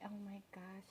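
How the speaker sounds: soft, unvoiced oh my gosh; whisper. Real, not acted. Vlogger whispering over her cake. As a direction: barely breathe the words.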